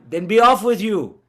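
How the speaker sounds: spirit voice. Retold or imitated. Retelling the ghost exchange, high and forceful. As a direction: rise up and banish it. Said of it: "And then you hear this go".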